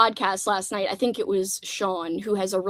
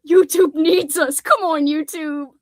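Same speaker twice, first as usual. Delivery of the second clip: mock crying; 6 semitones higher, 5 dB louder, slower than usual. Pleading with YouTube, mock crying.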